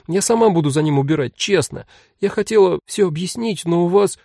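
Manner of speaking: high-voiced child making her case; pleading little girl. A narrator's reading. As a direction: gush about the kitten like a hopeful kid. Child pleads to keep kitten.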